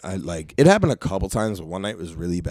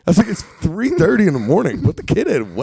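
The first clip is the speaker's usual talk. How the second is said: exasperated yell; 6 dB louder than usual. Retold or imitated. Retold standoff, laughing at stranger.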